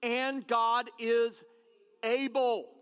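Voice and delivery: And God is, then a pause before able; drawn-out refrain. Shown as a demonstration. Pastor reminding the congregation, drawn out with a pause. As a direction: hold back, then drop able. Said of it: "let me remind you".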